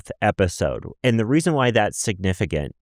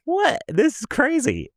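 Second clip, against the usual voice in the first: higher, breathy gasp of "What?"; mock shock; slower than usual. Co-host retelling his reaction to a fan, mock amazement. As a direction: act amazed at being recognized. Retold shock at being recognized.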